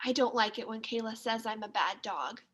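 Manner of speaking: ordinary delivery, nothing marked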